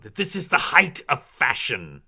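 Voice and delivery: high, loud indignation; outraged noblewoman voice; offended drow matron. Acted. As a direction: haughtily defend your taste, stung. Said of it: "She is so mad".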